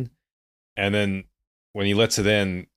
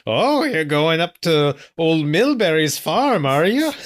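put-on higher, louder, slow British-sounding old-timer warning; crazy old gas station attendant; 8 semitones higher, 6 dB louder, slower than usual. Teasing a co-host by doing a crazy old attendant warning teens. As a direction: drawl a spooky warning like a backwoods attendant. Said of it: "the crazy old gas station attendant".

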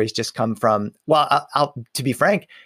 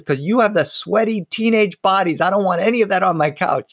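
grandmother voice; 7 semitones higher than usual. Retold or imitated. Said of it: "And she says".

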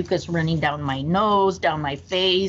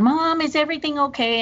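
put-on higher-pitched, worried little boy; voicing little son; 10 semitones higher than usual. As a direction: ask like a scared six-year-old. Six-year-old son asking.

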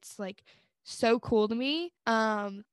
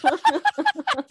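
high, loud burst of laughter; loud laughing fit; 7 dB louder than usual. Real, not acted. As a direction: crack up out loud. Cracking up at the croissant.

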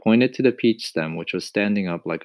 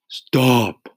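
crying out; 6 semitones higher, 8 dB louder than usual. A narrator's reading. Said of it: "cried the earthworm".

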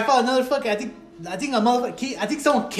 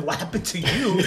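lightly imitated grandpa, softer; questioning grandpa; 3 semitones lower, 6 dB quieter than usual. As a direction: ask it as a quietly puzzled grandfather. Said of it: "and then my grandpa".

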